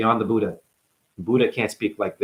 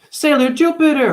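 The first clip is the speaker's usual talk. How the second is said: very high, loud cry; heroic call-out; 6 dB louder than usual. Acted. Calls out anime name.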